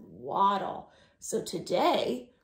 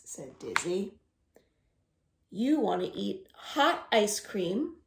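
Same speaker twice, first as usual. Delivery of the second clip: plain reading voice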